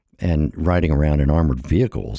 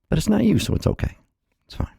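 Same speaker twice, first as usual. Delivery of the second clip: much higher mock voice dropping to a whisper; mock the viewer's excuse; sarcastic; faster than usual.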